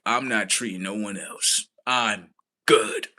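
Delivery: ordinary delivery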